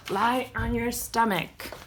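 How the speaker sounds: plain reading voice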